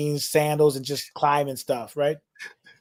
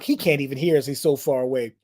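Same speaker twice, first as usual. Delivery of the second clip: ordinary delivery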